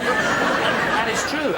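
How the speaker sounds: laughing through the words; chuckling it out. Real, not acted. Laughing at own story.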